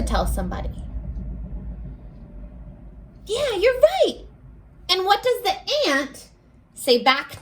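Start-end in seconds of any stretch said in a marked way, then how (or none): none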